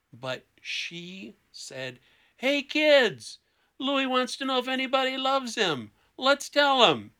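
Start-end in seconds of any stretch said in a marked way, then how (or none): 2.4-7.1 loud, high mocking call to the kids; a mother taunting her son in front of siblings.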